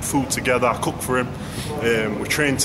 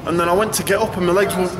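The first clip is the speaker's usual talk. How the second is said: usual speaking voice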